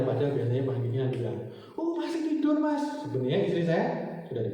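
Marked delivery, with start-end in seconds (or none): 1.7-3.0 high-pitched polite doorstep voice; household member's reply. Retold or imitated. Someone at home answering an early suitor, high polite reply. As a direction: answer the visitor in a put-on high voice.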